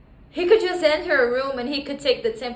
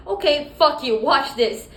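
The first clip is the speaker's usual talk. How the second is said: louder, higher, retold defiant vow; defiant outburst; 4 semitones higher, 8 dB louder than usual. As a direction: throw it down like an angry dare. Retold angry vow to prove him wrong.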